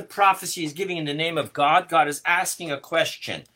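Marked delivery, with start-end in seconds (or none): none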